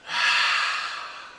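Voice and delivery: long breath out before the plunge; calming exhale. Shown as a demonstration. At the top of a water slide, a steadying exhale. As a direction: let the air out slowly. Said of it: "and you go".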